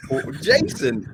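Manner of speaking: high, slow, drawn-out gasp of recognition; high, slowed, delighted double take; surprised recognition. Retold or imitated. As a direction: stretch the name in happy disbelief. Recognising a boxer, laughing.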